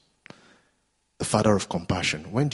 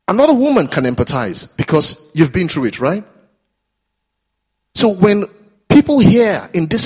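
loud, high, impassioned preaching; fervent pulpit climax, voice lifted high and loud; preaching at full force; 6 semitones higher, 7 dB louder than usual. Real, not acted. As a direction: rise and drive it home to the congregation.

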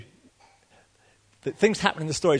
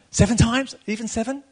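high, incredulous mock question; lightly voiced boastful Peter; mock astonishment; 8 semitones higher, 4 dB louder than usual. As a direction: act amazed at the number. Mocking Peter's big offer.

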